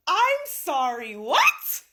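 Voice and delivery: loud, high, drawn-out disbelief; incredulous outburst. Acted. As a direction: stretch it out, then shoot up on WHAT. Reacts in disbelief to the story.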